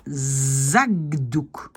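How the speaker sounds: Zagdouk drawn out slowly with a hard voiced g; slow demo of g before d in Zagdouk. Shown as a demonstration. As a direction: stretch Zagdouk, turning the k into g. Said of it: "on va dire".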